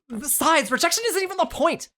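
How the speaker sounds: put-on high teen girl's voice; earnest teen girl. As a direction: explain it in a high girlish voice.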